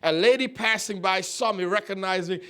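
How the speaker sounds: normal talking voice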